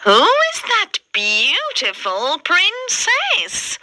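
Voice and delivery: high, slow jealous sneer; ugly sister's voice. A narrator's reading. Ugly sister eyeing the princess at the ball, high and slow. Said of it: "says one of the ugly sisters".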